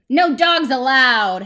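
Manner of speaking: slow, very loud yelled order; Hank. Acted. Cactus warning off a coyote, yelled. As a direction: bellow it, stretching out each word. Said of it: "Hank yelled".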